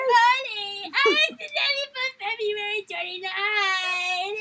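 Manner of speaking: high, loud, strained put-on voice; mock panicked cashier.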